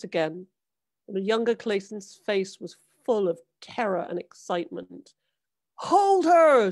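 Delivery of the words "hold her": high, loud command; shouting. A narrator's reading. Father shouting at his son to hold the mermaid, loud and high. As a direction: yell at your son to hold on. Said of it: "shouted the father".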